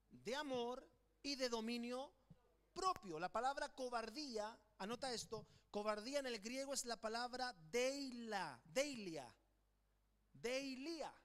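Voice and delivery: ordinary talk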